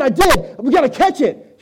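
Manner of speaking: high, loud urgent insistence; husband insisting to wife, high and loud; urgent excitement. Retold or imitated. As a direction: push her to help right now. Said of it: "And I said".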